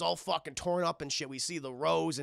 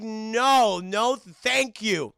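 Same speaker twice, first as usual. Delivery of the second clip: loud, higher, drawn-out no; firm refusal; 5 semitones higher, 8 dB louder, much slower than usual. Retold or imitated. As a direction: pointedly stretch out a mock-polite refusal. Belle firmly refuses.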